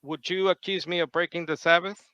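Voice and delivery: ordinary talk, nothing marked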